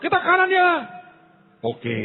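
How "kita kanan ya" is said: high, bossy instruction; someone instructing. Retold or imitated. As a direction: order the direction casually. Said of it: "Ada orang berkata". (orang memberi perintah dengan nada tinggi)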